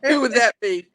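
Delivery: loud, high, through laughter; laughing. Real, not acted. Teasing friends about who has the accent, laughing. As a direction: laugh while asking, voice lifted.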